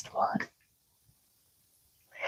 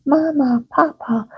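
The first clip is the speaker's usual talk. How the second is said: doll voice; 8 semitones higher than usual. Acted. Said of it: "and she says".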